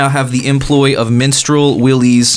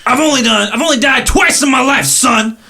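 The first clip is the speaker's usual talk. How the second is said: Minstrel Willie voice; 11 semitones higher than usual. Acted. Minstrel Willie bragging to the stranger, high boastful voice. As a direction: brag like a battle-worn showman. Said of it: "Looks at you and goes".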